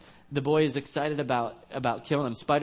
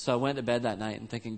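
ordinary talk, nothing marked

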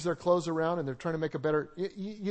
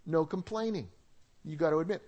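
normal talking voice

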